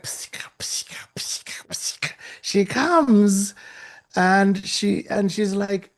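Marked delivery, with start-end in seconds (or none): 0.0-2.1 whispered psika psika of flapping sandals; sandal slap noise. Retold or imitated. Mimicking the lady's sandals flapping, whispered. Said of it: "She was like".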